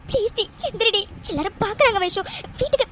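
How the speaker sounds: usual speaking voice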